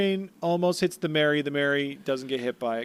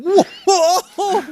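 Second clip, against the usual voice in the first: mock shock; 9 dB louder than usual. Mock amazement at fake fact.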